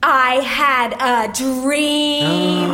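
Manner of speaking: extremely drawn-out stage declamation; overwrought monologue. Acted. Actress telling her dream to director, overwrought delivery. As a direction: draw every word out with huge theatrical weight. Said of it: "Break 'em down and then build 'em back up".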